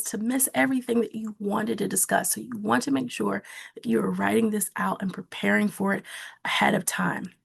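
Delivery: usual speaking voice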